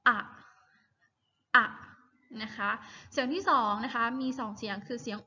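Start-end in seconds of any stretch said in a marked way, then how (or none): 0.1-0.2 short clipped อะ vowel sound. Shown as a demonstration. Teacher modelling the vowel sound for class, short อะ. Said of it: "ก็คือเสียง". 1.6-1.7 short clipped อะ vowel sound. Shown as a demonstration. Teacher modelling the vowel sound for class, short อะ. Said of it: "ก็คือเสียง".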